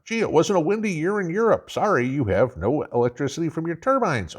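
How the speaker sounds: mock utility telling Europe sorry; mocking skit voice. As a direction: play the sheepish power company. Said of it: "acted mock dialogue about Europe".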